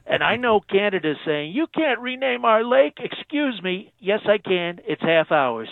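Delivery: channel a bragging president on the phone; Trump impression. President phoning into radio show, Trump-style boast.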